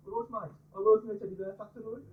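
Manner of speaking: high-pitched, exaggerated Brummie accent. Shown as a demonstration. Comic showing the crowd how to do a Brummie accent, exaggerated Brummie. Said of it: "all you have to do is say this one sentence".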